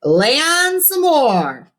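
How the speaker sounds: high, slow showy radio host. A narrator's reading. Cousin Ralphie answering the caller, showy radio DJ. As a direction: sell it like a DJ on air.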